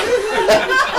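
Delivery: high cackling laugh; wild rambling cackles. Real, not acted. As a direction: cackle at your own joke. Laugh after old school.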